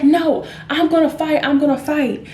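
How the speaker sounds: high-pitched mock belligerence; combative outburst. Mother threatening a fight with passenger, mock combative.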